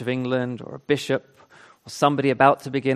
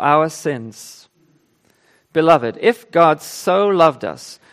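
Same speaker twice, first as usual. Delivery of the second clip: solemn, measured Bible reading; scripture reading; 3 semitones higher, 5 dB louder than usual. Acted. Reads scripture aloud in church.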